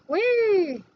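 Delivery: high, loud gleeful whee; playful whee. Real, not acted. Instructor cheering as the part breaks free, playfully excited. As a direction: let out a little whoop of fun.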